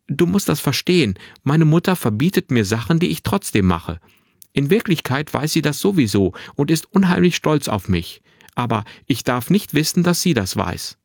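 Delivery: put-on high, animated boy explaining himself; earnest, chatty boy. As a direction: explain eagerly in a boyish voice. Narrator voices the boy Finn.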